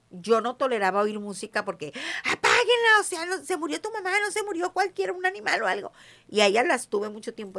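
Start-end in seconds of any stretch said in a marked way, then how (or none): 2.2-5.9 much higher, pained cry of protest; anguished outburst. Retold or imitated. Mother ordering daughters to shut off the music, high anguished outburst. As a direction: beg them to turn it off, voice rising high.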